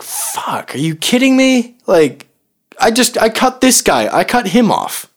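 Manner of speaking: high-pitched, worked-up disbelief; retold exasperation. Driver reliving seeing police lights behind him, exasperated. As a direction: relive the moment, voice jumping up high. Retelling his panic at flashing lights.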